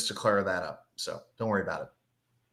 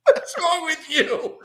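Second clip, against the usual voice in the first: high, laughing mock outrage; 14 semitones higher, 4 dB louder, slower than usual. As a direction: squeal it through laughter. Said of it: "I'm like".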